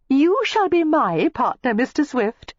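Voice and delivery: put-on high, loud girlish cry; excited cry. A narrator's reading. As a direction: she grabs him as partner, thrilled. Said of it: "cried Miss Layton".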